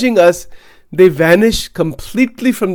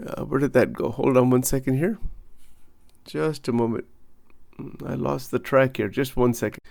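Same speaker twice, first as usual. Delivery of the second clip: low, much softer aside to self; quiet aside; 6 semitones lower, 12 dB quieter, faster than usual. Real, not acted. Teacher who lost the track, quiet aside. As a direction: talk under your voice while finding your place.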